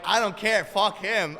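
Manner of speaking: hammed-up caller waving it off; mock dismissive client. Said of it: "He's like".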